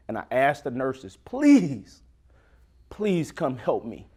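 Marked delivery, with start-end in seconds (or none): none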